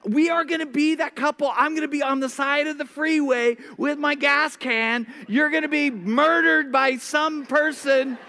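high, rushing panicked rant; stressed-out panic. Retold or imitated. Husband frets to laughing wife about being stranded, high and panicked. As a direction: spiral into comic worst-case panic. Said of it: "because I'm stressed out".

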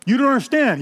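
acted desperate insistence; insistent protest. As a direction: push back hard, pitch jumping up. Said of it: "he persisted".

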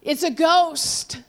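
mildly acted frightened yell, drawn out; fearful yell. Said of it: "they yell out".

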